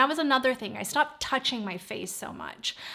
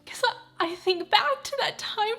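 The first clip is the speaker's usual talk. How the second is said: high, partly whispered tearful voice; holding back sobs while talking; crying, real; 10 semitones higher than usual. Real, not acted. Vlogger tells viewers about her parents' birthdays, crying. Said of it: "I hate crying on camera".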